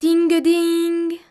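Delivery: high, ringing sung-out chime; imitated coin jingle. A narrator's reading. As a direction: ring out like coins clinking. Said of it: "il les fit tinter".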